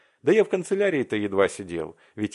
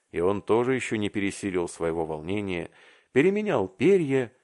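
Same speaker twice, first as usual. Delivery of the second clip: plain reading voice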